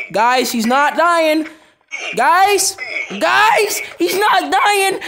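high, loud, slow pleading yell; frantic disbelief. Real, not acted. Gamer yelling to viewers that the enemy won't die. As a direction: call out to the viewers in panic.

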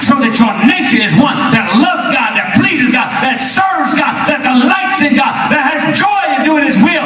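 escalating revival shout; rising preacher shout. Real, not acted. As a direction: climb to a full-throated preacher's cry. Preacher's climax.

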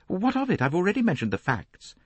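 high-pitched, irritated retort; defensive lady, pitched high; sharp. A narrator's reading. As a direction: cut him off sharply. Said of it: "Lynette said sharply".

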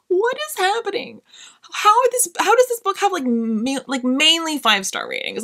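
very high, squeaky incredulous questions; high-pitched mock outrage at the ratings; baffled disbelief. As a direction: sound utterly baffled, voice shooting up. Retold baffled reaction.

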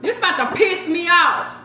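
높고 크게, 짜증 폭발 직전; frustrated outburst. Real, not acted. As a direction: snap with real frustration at the stuck jar. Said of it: "I'm about to get mad".

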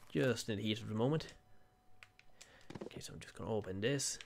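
ordinary talk, nothing marked

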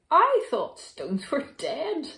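loud, high, thrilled outburst; excited storybook bear; crying out. A narrator's reading. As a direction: exclaim it with joyful amazement. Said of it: "cried the bear".

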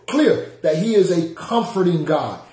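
usual speaking voice

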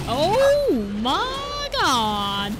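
very slow, stretched-out wail; drawn-out alarm. Real, not acted. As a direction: stretch every word in dismay. Shocked as the fire spreads.

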